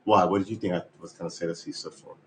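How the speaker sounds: ordinary talk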